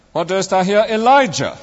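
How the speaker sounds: "Elijah" pitched high, then falling away; stress on ELIJAH. Retold or imitated. Friend mimicking a preacher's closing point, stress on "Elijah". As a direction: save the big emphasis for the last word, "Elijah". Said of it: "emphasizing each word in turn".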